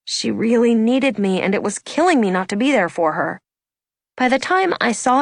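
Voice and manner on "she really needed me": usual speaking voice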